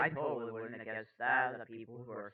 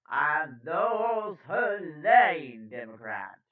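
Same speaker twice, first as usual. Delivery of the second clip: slow, prestigious news-anchor delivery; 2 semitones higher, 12 dB louder, much slower than usual. Acted. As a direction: stretch the key words with grand importance. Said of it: "I have to do my prestigious voice".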